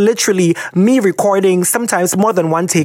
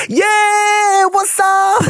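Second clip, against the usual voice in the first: hyped-up greeting; much slower than usual. Retold or imitated. Mocking the hype fans expect from him. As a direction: bounce in like a party host. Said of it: "they expect me to be like".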